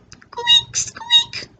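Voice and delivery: tiny, very high mouse squeaks. A narrator's reading. Storyteller voices the tiny little mouse, high squeaks. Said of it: "The mouse says".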